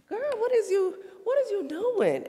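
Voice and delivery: high, laughing mock scold; sassy 'girl, what is you doing'; mock scolding mom. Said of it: "And I was just".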